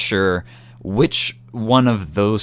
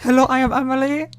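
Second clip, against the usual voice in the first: pitched way up, parodying the greeting; high mock introduction. Teasing the traveler, repeating his greeting in a high voice. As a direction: chirp it like a sweet stranger.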